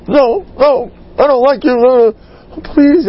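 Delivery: put-on high, loud pleading kid; whiny child. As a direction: beg like a sulking child.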